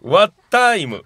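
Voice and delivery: ワッターイム said slowly, with a long drawn-out ター; Okinawan word. Shown as a demonstration. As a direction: spell out ワッターイム clearly for the quiz. (沖縄の言葉ワッターイムをゆっくり発音)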